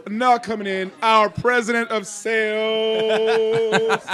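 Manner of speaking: very slow, loud PA announcement; announcer voice. Retold or imitated. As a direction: stretch it out like a PA introduction. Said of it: "I did an announcement".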